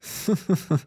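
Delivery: a scoffing snort of a laugh; short snort. A narrator's reading. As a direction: snort at the idea. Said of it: "Уза спирхнув". (пирхання)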